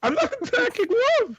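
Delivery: very high-pitched protest; indignant squeal. Real, not acted. Defending himself against attacking love, high indignant squeal. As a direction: shoot up into a squeaky denial.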